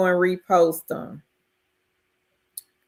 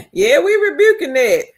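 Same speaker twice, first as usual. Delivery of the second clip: high, loud laughing declaration; laughing agreement; 13 semitones higher, 5 dB louder than usual. Real, not acted. Host agreeing with a viewer's rebuke, laughing loudly. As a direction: laugh and proclaim it.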